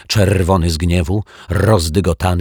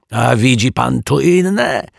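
high, louder, slow yell; 7 semitones higher, 5 dB louder, slower than usual. Acted. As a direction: bellow it at him. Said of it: "wydarł się pan Mietek". (wydziera się wściekle)

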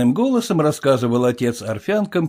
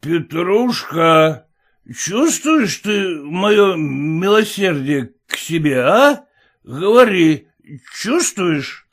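put-on drunk father; 5 semitones higher, slower than usual. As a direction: demand thanks like a drunk. Drunk father demands gratitude.

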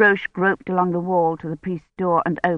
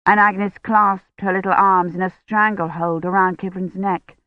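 ordinary delivery, nothing marked

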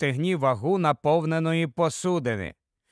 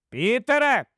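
high-pitched motherly reprimand; raised voice; 7 semitones higher, 3 dB louder than usual. Acted. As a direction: call the child sternly, voice lifted. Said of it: "Дора підвищила голос". (підвищений материнський голос)

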